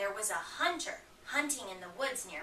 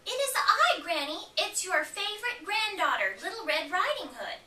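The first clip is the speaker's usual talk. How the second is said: put-on little girl voice; 8 semitones higher, 4 dB louder than usual. Red answers at the door.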